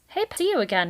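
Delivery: high loud greeting; crying out. A narrator's reading. Mister Kanad greets Valerian, high loud hail. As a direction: hail him like an old friend. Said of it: "he cried out".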